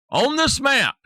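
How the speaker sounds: emphatic call-out. Real, not acted. Announcing the map's rules.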